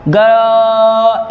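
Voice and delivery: "ग" held long with its vowel; drawn-out syllable. Shown as a demonstration. Teacher showing the second syllable, "ग" drawn out. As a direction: stretch "ग" on one steady note. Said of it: "ये आ रहा है".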